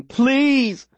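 high slow pleading call; desperate calling. Acted. Boy running to the fallen girl, desperate and high. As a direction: call her name as you run, begging.

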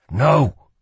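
loud, defiant refusal. A narrator's reading. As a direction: refuse with sudden force. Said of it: "thought Cal".

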